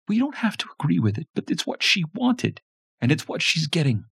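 high, firm scolding wife; firm mother voice. A narrator's reading. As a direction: cut him off firmly.

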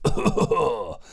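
acted sickly cough; demonstrated cough. Game master showing the son's illness, a drawn-out cough. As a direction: cough like the ill son. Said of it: "had a cough".

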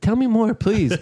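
high, loud feigned curiosity; mock eager interest. Humouring a conspiracy-talking figure with fake eagerness. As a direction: pretend to be hooked and egg him on. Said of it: "first I played along with it".

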